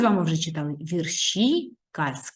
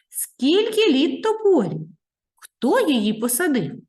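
put-on curious child; 8 semitones higher, 5 dB louder than usual. Child asks mother.

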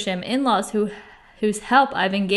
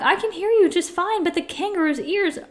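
higher-pitched reply across a distance; calling back; 8 semitones higher than usual. A narrator's reading. Horton answers the tiny mayor, calling back. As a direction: call back reassuringly, voice lifted. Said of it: "And Horton called back".

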